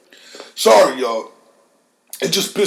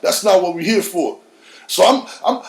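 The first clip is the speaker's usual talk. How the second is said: usual speaking voice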